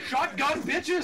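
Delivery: loud gloating battle cry; triumphant shout. Real, not acted. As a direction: yell it out in triumph. Gaming callout, triumphant yell.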